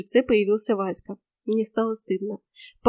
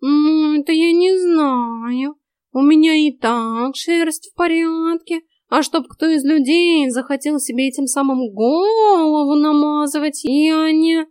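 higher, loud, slow cat drawl; talking cat, evasive; 5 semitones higher, 6 dB louder, slower than usual. A narrator's reading. Cat excuses himself to the heroine, drawling evasively. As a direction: draw it out high and loud, hedging.

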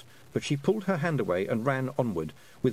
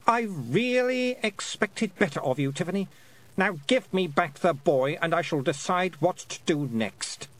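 cold queen; 7 semitones higher than usual. A narrator's reading. Queen voiced, cold threat.

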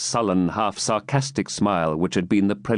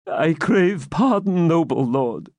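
high, slow timid plea; bow and beg; timid old man; 7 semitones higher, slower than usual. Acted. Captive begging the baron, timid. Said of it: "said Isaac timidly".

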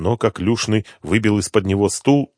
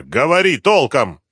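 higher, louder, drawn-out command; angry yell; 7 semitones higher, 6 dB louder than usual. A narrator's reading. Inspector demands sense from the addict, angry yell. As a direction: order him with a slow raised voice. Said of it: "продолжал орать инспектор".

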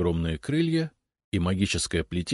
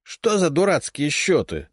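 high, loud scornful scoff; dismissive snort; 10 semitones higher, 5 dB louder than usual. A narrator's reading. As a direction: scoff it off with contempt. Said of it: "пренебрежительно фыркнула левая голова".

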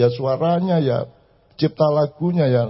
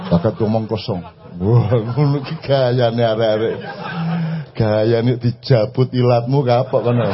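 low mocking retort; 9 semitones lower than usual.

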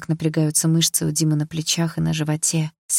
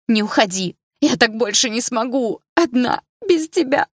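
despairing cry; 7 semitones higher, 3 dB louder than usual. A narrator's reading. Heroine breaking in despair.

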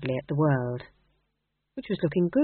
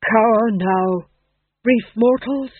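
slow, loud stage declamation; actor rehearsing Death; 4 semitones higher, 7 dB louder, slower than usual. A narrator's reading. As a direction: proclaim doom to mortals. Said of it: "his first speaking part".

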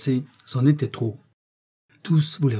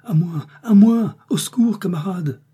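crying for help; 8 semitones higher, 6 dB louder than usual. A narrator's reading. Buried man emerging from the rubble, crying for help. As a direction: call out urgently, pitched far up. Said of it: "une voix cria".

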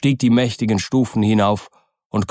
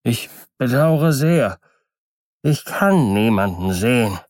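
plaintive, weak voice; 2 semitones higher than usual. A narrator's reading. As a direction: answer feebly, stretching the words in complaint. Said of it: "eine klagende, schwache Stimme von innen". (klagende, schwache Stimme)

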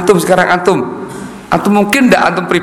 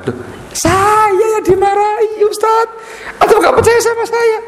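whining complainer. Acted. Student insisting to the ustaz he was scolded, high whiny laughing voice. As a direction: plead like an offended student, half laughing.

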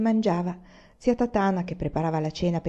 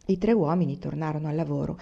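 plain reading voice

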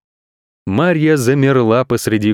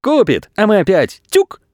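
high, gleeful voice; acted merry glee; joyful agreement; 8 semitones higher than usual. A narrator's reading. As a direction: say it with mischievous delight. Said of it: "радостно подтвердила мама".

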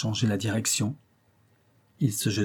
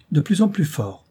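higher, louder exasperated cry; exclaiming baroness; 7 semitones higher, 4 dB louder than usual. Acted. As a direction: throw up your hands in the voice. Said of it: "s'écria la baronne". (la baronne s'écrie, plus haut et plus fort)

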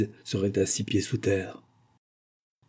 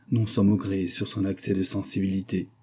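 ordinary delivery, nothing marked

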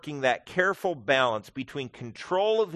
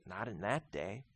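much softer, nearly under the breath; soft first take; 5 semitones lower, 12 dB quieter than usual. Real, not acted. Preacher answering his own question, much softer. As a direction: drop it low and intimate.

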